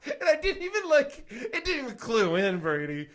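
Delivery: loud, high, talking through real laughter; cracking up at your own blunder; laughing. Real, not acted. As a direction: barely get the story out for laughing.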